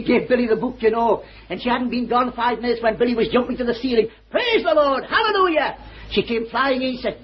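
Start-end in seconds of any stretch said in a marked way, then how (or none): 4.3-5.8 high joyful cry of praise; jubilant shout. Retold or imitated. Billy jumping with joy.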